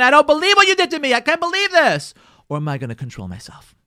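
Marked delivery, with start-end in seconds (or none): 0.0-2.1 high, loud outburst of outrage; screaming attack. Acted. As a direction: lash out in acted disbelief. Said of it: "lash out and criticize and attack and scream".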